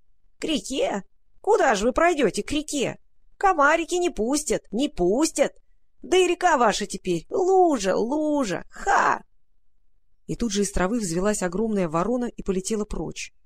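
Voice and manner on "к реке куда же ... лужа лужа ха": high, creaking, mocking animal voice; creaky rat-crow voice. A narrator's reading. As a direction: jeer like a nasty squeaky rat. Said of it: "мерзкий крысиный голос продолжал скрипеть".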